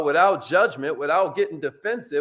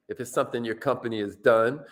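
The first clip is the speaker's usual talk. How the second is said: ordinary talk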